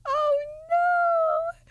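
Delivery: high, loud yelp of shock; shocked cry. Real, not acted. Streamer sees an image and yelps in shock. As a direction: squeal at a sudden image.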